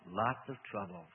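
low, slow relish; pleased. A narrator's reading. Demon boasting of trouble in the house, pleased. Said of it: "sounding pleased".

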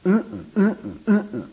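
loud, high, repeated grunts; engine-like grunting. Shown as a demonstration. Choir teacher mocks uniform emphasis on every word, loud rhythmic grunting. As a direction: grunt in hard, even bursts like an engine. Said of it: "there's this grunting".

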